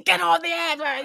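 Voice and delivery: high newsroom shout; high newsroom yell; shouted order. Retold or imitated. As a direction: call it across a busy room.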